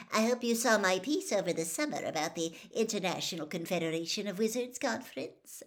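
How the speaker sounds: gushing greeting. Acted. Reporter gushes a greeting to the headmaster. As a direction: lay the charm on thick.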